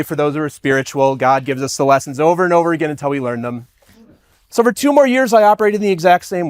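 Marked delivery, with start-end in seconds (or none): 4.5-6.5 emphatic jump. Real, not acted. Dramatic turn.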